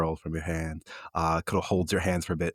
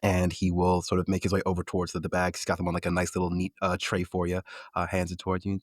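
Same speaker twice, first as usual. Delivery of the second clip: normal talking voice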